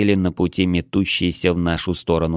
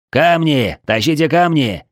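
high, loud call for help hauling; distant shouting; 7 semitones higher, 4 dB louder than usual. A narrator's reading. Man shouts from afar to the defenders, high urgent yell. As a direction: yell the order across a distance. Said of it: "кричал откуда-то издалека Притус".

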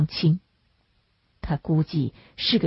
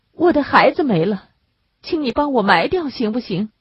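higher-voiced grieving mother asking a favour; pleading young woman; 6 semitones higher than usual. A narrator's reading.

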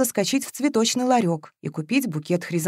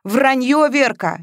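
loud, higher, heated comeback; outraged, raised voice; angry retort; 6 semitones higher, 5 dB louder, slower than usual. A narrator's reading. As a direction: throw the accusation back hard. Jealous man throws word back.

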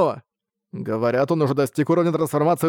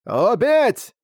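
high shouted battle command; one sharp, high-pitched yell; old man's cry; 9 semitones higher, 4 dB louder than usual. A narrator's reading. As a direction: cry out the order to attack. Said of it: "вскричал старик".

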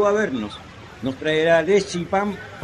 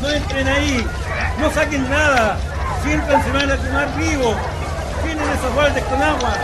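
higher and loud, ordering people back; urgent warning shout; 8 semitones higher, 5 dB louder than usual. Acted. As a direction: bark urgent orders at the crowd. Warning neighbours away from fire. (gritos urgentes advirtiendo del incendio)

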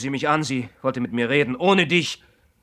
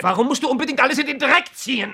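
much higher, pained outcry; anguished protest; 12 semitones higher, 4 dB louder than usual. Acted. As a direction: cry out against the accusation. Quarrel, hurt protest.

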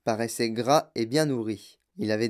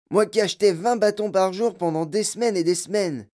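put-on high, aggrieved boy's voice; peevish, higher-pitched whine; sullen complaint; 7 semitones higher, 4 dB louder than usual. A narrator's reading. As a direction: protest sulkily at the injustice. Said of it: "cria d'une voix maussade".

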